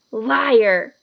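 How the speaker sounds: sudden defiant shout; boy shouting back at the villain. A narrator's reading. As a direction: spit the word out at him. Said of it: "Harry shouted suddenly".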